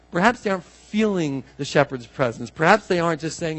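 ordinary delivery

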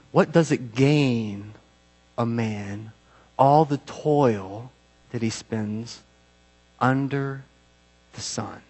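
slow, hushed verse with long pauses; solemn scripture reading. Acted. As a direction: let each phrase sink in, dropping away. Reads the verse slowly.

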